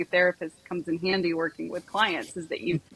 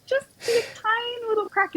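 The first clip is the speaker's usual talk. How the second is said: tiny high squeak. Acted. Therapist playfully showing how little she opens the door, tiny high squeak. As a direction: squeeze it up high, as small as the crack. Miming a barely opened door.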